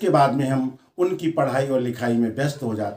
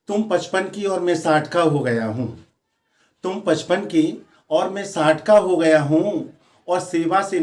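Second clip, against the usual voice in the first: higher-pitched, lilting declamation; poem recitation; 3 semitones higher than usual. Acted. Retired husband addressing his wife in verse, raised declaiming voice. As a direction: declaim each phrase with a lifted pitch.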